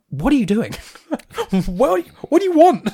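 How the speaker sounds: high-pitched incredulous protest, breaking into laughter; mock exasperation. Film fan challenging someone who disliked the film, mock exasperated. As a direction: demand it in baffled, laughing disbelief.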